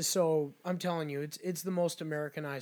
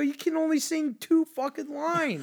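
higher, slow indignant complaint; mock indignant; 10 semitones higher, 5 dB louder than usual. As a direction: scold the busker for short song. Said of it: "I go".

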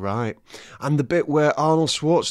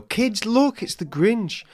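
excited parent; 11 semitones higher, slower than usual. Retold or imitated. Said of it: "and then they go".

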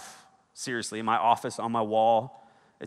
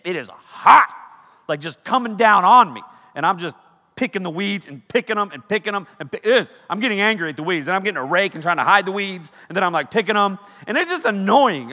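exasperated rant; 7 semitones higher, 7 dB louder than usual. Real, not acted. Frustrated rant about weeds.

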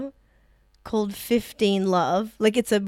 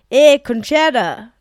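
loud, drawn-out Scottish imitation; attempted Scottish accent; 3 semitones higher, 9 dB louder, slower than usual. Comic retrying a Scottish friend's line, attempted Scottish accent. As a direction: have a bad go at a Scottish greeting. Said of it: "That's wrong".

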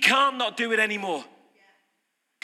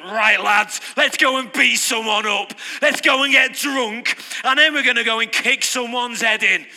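loud laddish mock rallying call, louder; mock yob rallying mates; 6 dB louder than usual. Preacher voicing laid-off lads rallying, loud mock yob. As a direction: bellow it like a lad down the pub.

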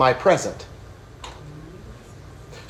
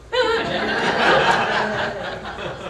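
hearty laugh; 9 semitones higher than usual. Real, not acted. Laughs at his own joke.

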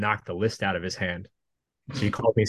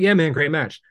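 casual, higher "great match" tossed off; breezy praise; 7 semitones higher, 3 dB louder than usual. Retold or imitated. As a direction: toss off "Yeah, man, great match." lightly, not really listening. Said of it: "I was like".

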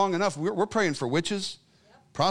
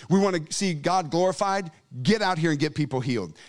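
ordinary talk, nothing marked

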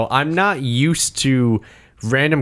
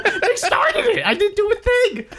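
mock innocent; slower than usual. Mock innocence retelling shootout.

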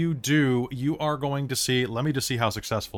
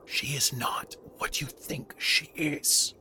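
whispering; 2 semitones higher, 4 dB quieter than usual. Acted. Villain voice warns.

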